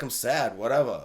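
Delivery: low, soft, dismissive mope; mock glum. Reviewer rewriting Jacob's return, mock glum. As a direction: toss it off low and sulky. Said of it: "be like".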